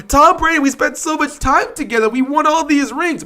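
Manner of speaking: high, soft mock lament; mock sad fan. Mocking fans crying about Tom Brady. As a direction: sigh it like a lovesick fan.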